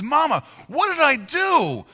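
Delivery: high, drawn-out anguished plea; crying, yelling child. Retold or imitated. Abandoned boy calling to his mama, crying and yelling. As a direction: wail it slowly to a parent who is leaving. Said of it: "he's yelling".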